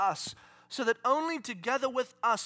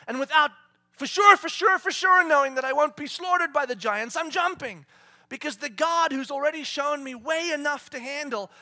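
ordinary talk, nothing marked

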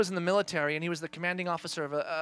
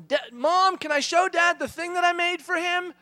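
put-on high-pitched, eager child voice; little kid; 10 semitones higher, 5 dB louder than usual. Child asking mom to let her show dad a gift, high and eager. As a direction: ask as a pleading youngster. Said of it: "I hear Hadley say".

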